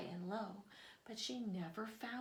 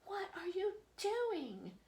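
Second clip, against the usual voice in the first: outraged mother crow; 10 semitones higher, 5 dB louder than usual. Acted. Crow confronts snake.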